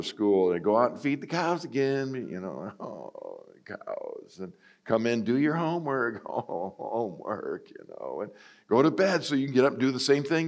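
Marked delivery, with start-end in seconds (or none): 2.8-4.4 very low, breathy grumble; deflated mutter; mock teen groan. Teen groaning about chores. 5.2-6.2 higher, lightly voiced parent; a hint of a stern parent; parent's order. 6.3-7.7 slow, softer mopey whine; dejected drawl; mock teen groan. Teen groaning about chores.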